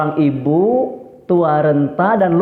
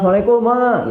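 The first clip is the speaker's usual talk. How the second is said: calling out greeting; 7 semitones higher than usual. Retold or imitated. Son arriving home calls to mother.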